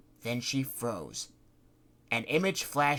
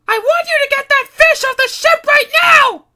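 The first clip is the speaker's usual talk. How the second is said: much higher and louder panicked scream; screaming cry; 20 dB louder than usual. Acted. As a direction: shriek the order in terror. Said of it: "she immediately cried".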